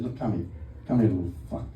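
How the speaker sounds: low, hushed talking-to; mock stern aside. Comedian acts having a word with his toddler Ted, low and quiet.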